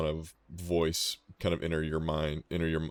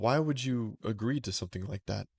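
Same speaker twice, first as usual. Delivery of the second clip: higher-pitched goddess recalling, then questioning; high-voiced goddess interrogating a mortal; Titania; 5 semitones higher than usual. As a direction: speak as a questioning deity, pitched up. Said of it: "similar voice you guys hear from Titania".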